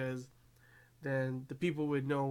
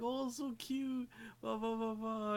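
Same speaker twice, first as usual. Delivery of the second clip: gushing squeal; 8 semitones higher, 4 dB quieter than usual. Retold or imitated. Siblings petting the cute animal girl, gushing.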